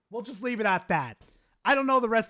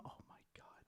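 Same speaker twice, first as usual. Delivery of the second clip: a voiceless, disbelieving whisper; stunned whisper; 32 dB quieter, faster than usual. Real, not acted. Streamer reacting to a chat message, whispering in disbelief. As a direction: breathe it out under your breath.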